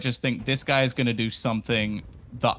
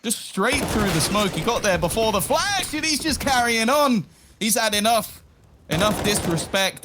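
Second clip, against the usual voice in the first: raised, hyped play-by-play; excited casting; 6 semitones higher than usual. Real, not acted. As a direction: build the hype on the play. Caster hyping a play.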